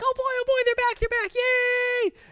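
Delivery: very high, loud giddy cheer; giddy squeal. Retold or imitated. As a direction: squeal like an overexcited kid. Overjoyed Torch quote.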